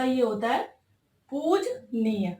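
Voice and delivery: पूजनीय said slowly, पूज-नीय with the vowel after ज dropped; slow correct form. Shown as a demonstration. Teacher correcting a common spelling for students, slow careful form. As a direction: spell out पूजनीय slowly, two clear parts. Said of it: "ये पूजनीय नहीं होता".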